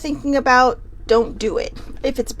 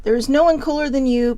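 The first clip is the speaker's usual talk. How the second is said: ordinary delivery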